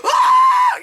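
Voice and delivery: very high, loud squealed scream; freak-out scream. Retold or imitated. Vlogger re-enacts getting the card, freak-out scream. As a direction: re-enact an excited freak-out with a piercing shriek. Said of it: "freaking out like a little bitch".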